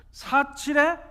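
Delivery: high, loud proclamation; loud declaration. Acted. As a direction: belt it out stubbornly. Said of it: "큰 소리로 막". (큰 소리로 외치기)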